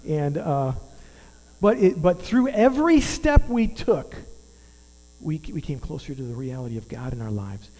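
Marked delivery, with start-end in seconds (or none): 1.6-4.1 high, loud and slow, leaning on every; preacher lifting the line up high; preaching emphasis. Real, not acted. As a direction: drive it home from the pulpit.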